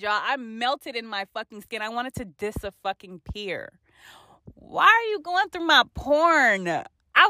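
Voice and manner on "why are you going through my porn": outraged. Real, not acted. Outraged at parents.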